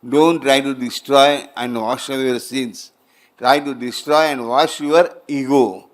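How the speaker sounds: emphatic preaching. Shown as a demonstration. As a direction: preach the central lesson with force. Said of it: "This sentence is very important".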